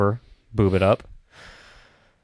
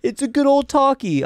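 put-on high, childlike sing-song; little kid voice; 14 semitones higher than usual. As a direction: play a small excited kid. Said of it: "like you're talking to, like, a three-year-old".

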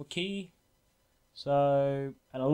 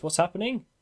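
usual speaking voice

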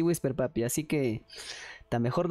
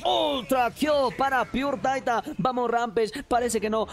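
high-pitched, breakneck team-fight call; frantic esports caster riding the action; excited play-by-play; 6 semitones higher than usual. Acted. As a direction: shout out the kills with stretched words.

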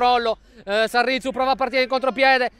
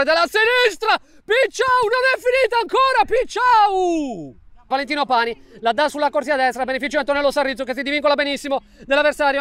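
high, excited call of the chance; excited goal commentary; 5 semitones higher than usual. Real, not acted. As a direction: build up to the near miss.

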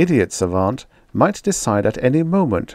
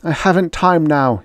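plain reading voice

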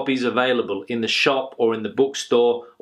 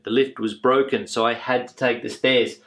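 ordinary delivery

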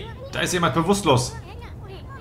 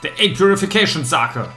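loud, raised excited call; excited announcement; 6 semitones higher, 5 dB louder than usual. Acted. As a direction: announce it like a treasure find. Announcing found item excitedly.

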